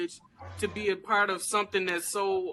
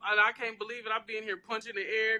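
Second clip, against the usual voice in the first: usual speaking voice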